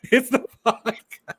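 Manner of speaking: slow, laughing joke at herself; giggling excuse through tears. Real, not acted. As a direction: laugh through the words to break the tension.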